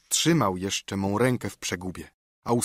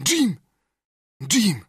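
delighted repeating, higher and louder; a hermit overjoyed at a name; pleased; 8 semitones higher, 4 dB louder than usual. A narrator's reading. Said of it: "powtarzał widocznie bardzo zadowolony". (zachwycone powtarzanie, wyżej i głośniej)

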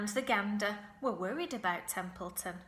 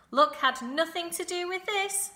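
high, loud protest; indignant correction; 9 semitones higher, 9 dB louder than usual. A narrator's reading. Goose claiming credit for her hatching, indignant correction. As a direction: put them firmly right, voice raised. Goose rebuts Charlotte's remark on luck.

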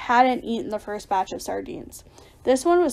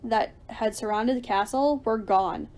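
plain reading voice

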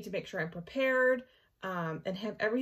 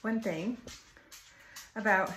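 shallow breathing; 2 semitones higher, 5 dB louder than usual. Real, not acted. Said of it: "sorry, I'm out of breath".

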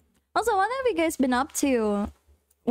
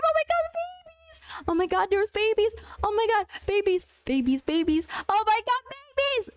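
real high-pitched fangirl squeals; 8 semitones higher than usual. Real, not acted. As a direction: gush at the babies, pitch way up. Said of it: "I'm just fangirling".

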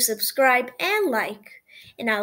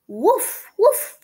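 puppy bark; 6 semitones higher, 6 dB louder than usual. Acted. Puppy barks in story.

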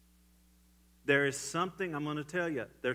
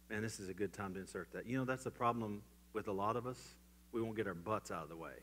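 ordinary talk

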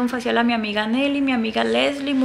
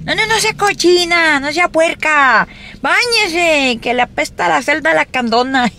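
scolding mom; 5 semitones higher than usual. Retold or imitated. Mother scolding her daughter to bathe. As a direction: nag like a strict mom about bathing. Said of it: "Mi mamá nos acostumbró así".

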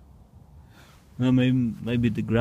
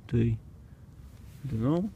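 normal talking voice

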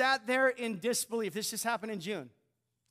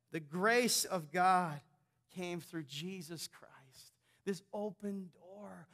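solemn quiet; 6 semitones lower, 6 dB quieter, slower than usual. Real, not acted. Solemn slowed preaching.

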